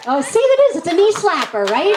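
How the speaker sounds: gleeful crowing. Real, not acted. Host delighted the audience laughed at her joke.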